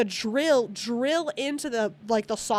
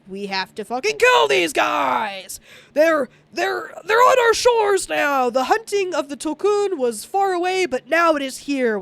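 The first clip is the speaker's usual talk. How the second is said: loud, high enraged yelling; rally them in fury, shouting; angry shout; 5 semitones higher, 5 dB louder than usual. Retold or imitated. Tribe furious the hunters came to their shores, shouting high. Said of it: "everyone gets pretty upset and hyped up".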